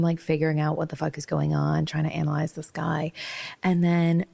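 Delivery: ordinary talk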